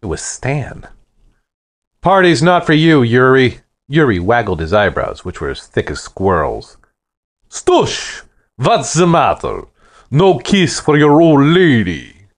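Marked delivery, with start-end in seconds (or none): 2.0-3.6 stern warning. Acted. Stan confronts the intruder. 7.5-12.3 big, loud, higher Russian bellow; booming Russian accent. Acted. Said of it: "his voice boomed like a backfiring car with a Russian accent".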